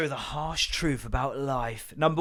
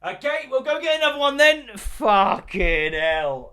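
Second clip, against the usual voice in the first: high, loud, exasperated resignation; slow laughing exasperation; 12 semitones higher, 8 dB louder, slower than usual. Retold or imitated. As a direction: throw up your hands in mock despair. Resigned reaction to spilled plant.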